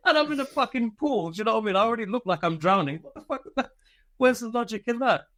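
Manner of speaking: high-pitched protest breaking into real laughter; laughing incredulity. Real, not acted. Recruit ordered to swim while unfit, laughing in disbelief. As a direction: laugh helplessly at the absurdity, voice climbing high.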